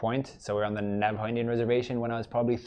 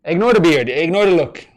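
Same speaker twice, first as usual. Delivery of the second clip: put-on Irish accent; 6 semitones higher, 11 dB louder than usual. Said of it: "is my Irish accent at least passable".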